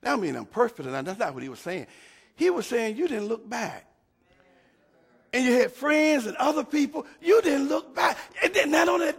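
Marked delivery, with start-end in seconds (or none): 5.3-9.2 rising fervor. Real, not acted. Preacher rises in fervor.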